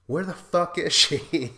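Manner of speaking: loud, high mock outburst; a mock-incredulous shout; exasperated outburst. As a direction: blurt it out like you can't believe it.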